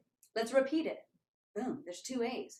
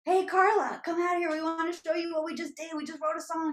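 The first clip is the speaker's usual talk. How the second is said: high, loud, eager summons; excited calling out; 8 semitones higher, 5 dB louder than usual. Retold or imitated. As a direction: call a friend over to share news. Excitedly calling desk lady over.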